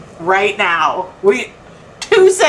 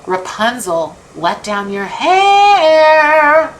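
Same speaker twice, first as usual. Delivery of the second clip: slow, drawn-out call up the tower; 6 semitones higher, 4 dB louder, much slower than usual. Acted. Calling up the tower as prince.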